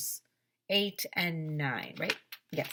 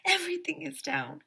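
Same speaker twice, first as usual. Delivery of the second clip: high-pitched cry of alarm; storybook panic, pitched way up; 13 semitones higher, 3 dB louder than usual. A narrator's reading. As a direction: announce disaster in a high voice. Teachers alarmed in the blackout.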